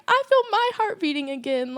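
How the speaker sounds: high-pitched tearful cry, retold. Speaker to God as the song plays, high tearful retelling. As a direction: let the voice climb high with tearful emotion. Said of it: "so I was just crying".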